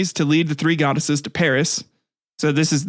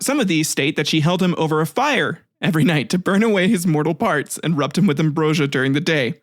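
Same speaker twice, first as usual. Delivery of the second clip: ordinary talk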